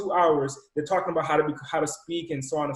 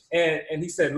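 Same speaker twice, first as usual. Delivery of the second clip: ordinary delivery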